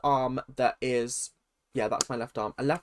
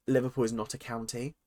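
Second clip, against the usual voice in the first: usual speaking voice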